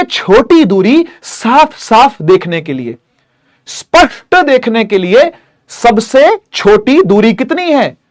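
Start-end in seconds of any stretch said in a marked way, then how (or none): none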